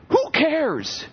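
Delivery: a high, brushing-off who cares; dismissive retort. Real, not acted. Preacher shrugging off vain thoughts, high and dismissive. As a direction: toss it away with scorn.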